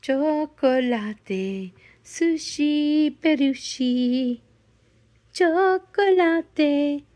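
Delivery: each word stretched very slowly; slow drawn-out demo. Shown as a demonstration. Coaching her dog Sushi to talk, very slowly. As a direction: draw the words out slowly for the dog to copy.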